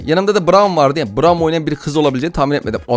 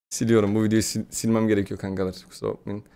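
ordinary talk

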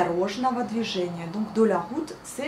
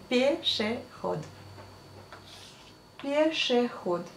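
1 slow repeat; 2 semitones higher, 5 dB quieter, slower than usual. Shown as a demonstration. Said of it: "repeats slowly for learners".

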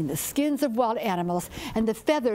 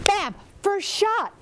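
rising, louder burst on the shot; excited bam; 10 semitones higher, 4 dB louder, slower than usual. A narrator's reading. Storyteller telling listeners of the first shot, excited. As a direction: punch out the gunshot with glee. Excited gunshot in hunting tale.

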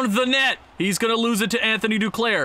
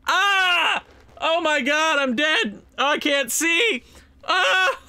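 pained yelp; 7 semitones higher, slower than usual. Real, not acted. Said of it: "I just stuck my finger in my eye".

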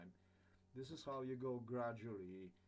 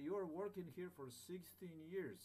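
slow insistent; 6 semitones higher than usual. Retold or imitated. Insisting to owner she doesn't understand.

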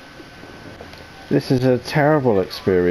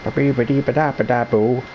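bouncy babble in the usual register; nonsense scat. Pretend. Player fills time with a silly jingle, nonsense scat. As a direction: rattle off nonsense syllables at speaking pitch.

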